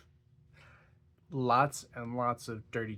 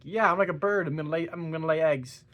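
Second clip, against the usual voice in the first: higher and louder, hammed-up and giddy; silly excuse; 5 semitones higher, 7 dB louder than usual. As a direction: blurt the stupidest excuse with pride. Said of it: "She's like".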